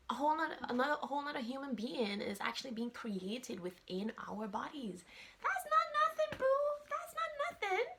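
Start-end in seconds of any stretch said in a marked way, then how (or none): 5.4-7.9 very high squeaky aside; high-pitched playful insistence. Pretend. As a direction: shoot up into a playful falsetto. Playful emphatic aside to listeners.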